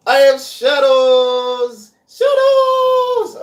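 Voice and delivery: high, loud, drawn-out mock cry; playful loud wail. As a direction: stretch it out and belt it high, hammed-up. Playful calling out about shadows.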